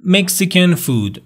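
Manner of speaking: drawn-out classroom model; slow model sentence. Shown as a demonstration. Teacher modelling a preference for the class.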